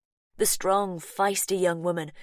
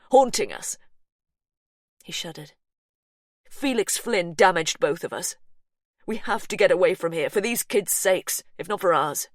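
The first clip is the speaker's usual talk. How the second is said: louder, driven; urgent plea; 6 dB louder than usual. Acted. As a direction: urge her to leave. Brother urging escape.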